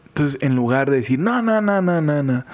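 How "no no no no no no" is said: high rapid string of no's, falling; mock flustered refusal of praise; rechazo imitado de elogios. (rechazo agudo y apurado, imitado)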